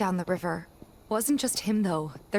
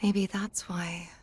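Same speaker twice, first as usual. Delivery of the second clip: slow, hushed whisper; secret whisper; slower than usual. Acted. Whispering a suspicion to her friend, slow hush. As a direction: breathe it so no one else hears.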